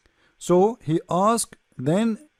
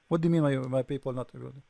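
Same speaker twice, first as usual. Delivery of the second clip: mock exasperated god; 5 semitones lower, 6 dB quieter, much faster than usual. Allah answering Muhammad, retold.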